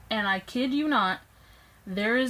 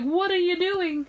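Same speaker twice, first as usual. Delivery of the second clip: high, raised indignant question; outraged protest; 10 semitones higher, 5 dB louder than usual. Retold or imitated. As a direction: protest in pain, pitched up high. Dad twisting hurt ankle.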